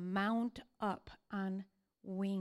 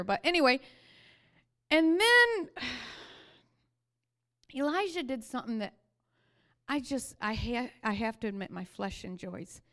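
ordinary talk

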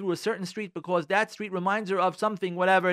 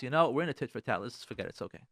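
softer, lower, letting it go gently; calm the spouse down, quietly; soothing to spouse; 6 semitones lower, 5 dB quieter than usual. Pretend. Husband making peace with wife, soft reassuring. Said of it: "say to your spouse".

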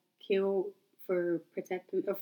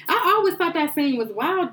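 high, loud, slow exclamation; animated disbelief at the scene; excited; 9 semitones higher, 7 dB louder than usual. Real, not acted. Reacting to wild scene.

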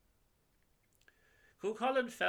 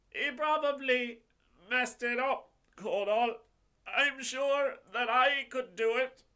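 much higher, brash tipsy boasting; boastful drunk warrior; 10 semitones higher, 5 dB louder than usual. Acted. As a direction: brag like a drunk hero. Drunk boastful Lara speaks.

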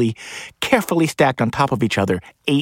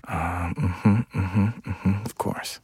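hushed murmur; 2 semitones lower, 7 dB quieter, much slower than usual. Acted. Feigning NPR interest.

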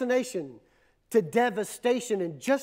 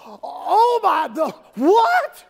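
loud, high shout of disbelief; shocked outcry; 5 semitones higher, 8 dB louder, much slower than usual. Acted. Woman seeing Samuel come up from the earth, shocked outcry. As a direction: cry out in startled shock.

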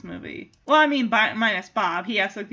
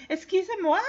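French poodle; 7 semitones higher, faster than usual. Retold or imitated. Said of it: "the poodle's like".

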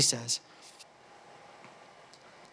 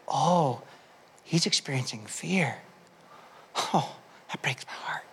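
fading to a soft whispered aside; tender whisper; 5 semitones lower, 11 dB quieter than usual. Retold or imitated. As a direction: breathe it out, heartbroken. Father's inner thought, tender.

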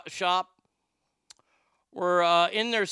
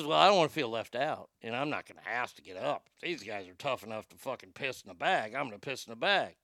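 low, soft, quick grumble; third guy; 7 semitones lower, 5 dB quieter, faster than usual. Acted. Third passenger reasons himself into joining in, low muttering. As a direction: mutter it low, talking yourself into it. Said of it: "the third guy says".

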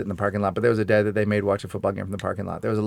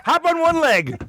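much higher, barked commands; mock drill coach. Mocking a dad ordering his son around the playground, high barks. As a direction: drill the kid like a harsh coach.

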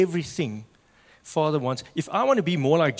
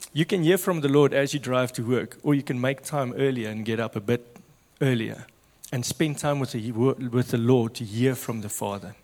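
normal talking voice

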